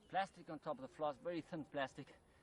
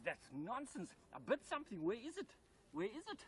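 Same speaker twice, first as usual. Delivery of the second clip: mock-indignant snake, rising much higher; 10 semitones higher than usual. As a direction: play the snake hunting for what it bit. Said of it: "Oh, he says".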